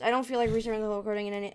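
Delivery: usual speaking voice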